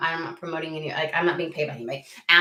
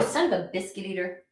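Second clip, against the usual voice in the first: sneeze and curse; 3 dB quieter than usual. Real, not acted. Sneeze then mock curse.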